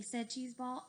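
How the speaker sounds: ordinary delivery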